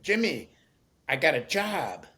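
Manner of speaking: excited. Retold or imitated. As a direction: burst out with the news like a delighted old man. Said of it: "in a very excited voice".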